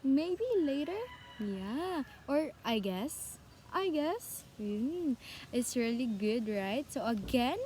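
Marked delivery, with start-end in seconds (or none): 0.0-1.0 "Maybe later" higher, with an unsure rise; higher-pitched, uncertain tune; fall-rise intonation. Shown as a demonstration. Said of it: "Like that". 2.6-4.4 "I guess" with an uncertain rise; doubtful tune ending upward; fall-rise intonation. Shown as a demonstration. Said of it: "shows confusion or uncertain thoughts".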